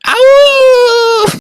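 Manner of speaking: long, high, loud howl; calling out howl. Acted. Rat calls out while riding a wolf in the chase, loud drawn-out howl. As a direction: throw out one sustained high-pitched "owww". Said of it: "calls out".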